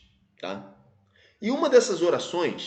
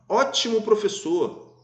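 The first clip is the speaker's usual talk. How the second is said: higher, drawn-out exclamation of praise; exclamatory; 5 semitones higher, slower than usual. Shown as a demonstration. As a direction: exclaim it slowly with admiration. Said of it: "Aqui era um ponto de exclamação".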